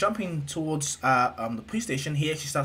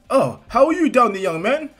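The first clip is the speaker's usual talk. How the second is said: loud, high, booming hero greeting; All Might; 9 semitones higher, 9 dB louder than usual. Acted. Hero calling down to a boy, loud and high. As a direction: call down heartily like a big hero.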